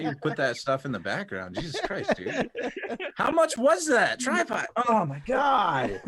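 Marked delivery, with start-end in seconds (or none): 1.6-6.0 high, loud disbelief; shocked. Real, not acted. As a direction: gasp out the shock. Shocked at tripod price.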